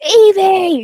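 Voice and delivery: loud, high cry of a name; panicked shout. Retold or imitated. Villain shouting as Evie falls, loud and high. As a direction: call out in panic. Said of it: "he gets up and he's like".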